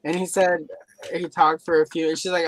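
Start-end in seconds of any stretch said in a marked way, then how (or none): none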